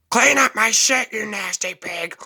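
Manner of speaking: high, loud shrieked squawk at the roommate; hammed-up old man shrieking high; mock drunk old man. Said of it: "He would always call me a nasty pig".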